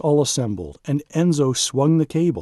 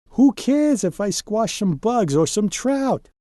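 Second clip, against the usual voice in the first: mad pouting; 8 semitones higher than usual. Acted. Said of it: "Donald got mad and he started to pout".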